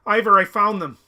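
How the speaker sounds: urgent call, high and loud. Acted. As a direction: shout to a companion some way off. Character calls out to companion.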